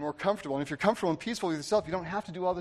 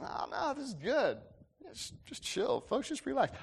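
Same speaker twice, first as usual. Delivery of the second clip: hushed, higher, easygoing imitation; relaxed; 9 semitones higher, 7 dB quieter than usual. Quarterback soothing a team that is freaking out, relaxed. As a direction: softly wave the worry away as the quarterback. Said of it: "Why was he so relaxed".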